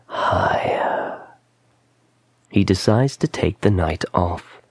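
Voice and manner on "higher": slow, drawn-out whisper. A narrator's reading. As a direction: whisper it as an insistent voice in his ear, stretching each word. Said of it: "The whisper in his ears".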